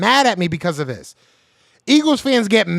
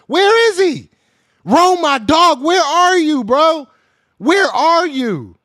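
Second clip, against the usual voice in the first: calling out; 8 semitones higher, slower than usual. Real, not acted. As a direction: shout for your buddy to show up. Calling out for a chat member.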